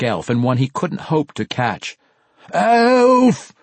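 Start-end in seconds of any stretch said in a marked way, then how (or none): 2.5-3.5 long, high, full-force bellow; drawn-out desperate holler; top-of-lungs scream. Acted. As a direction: scream it out as far as it will carry. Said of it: "he screamed at the top of his lungs".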